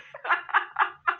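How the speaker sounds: breathy giggle. Real, not acted. Still laughing after yes.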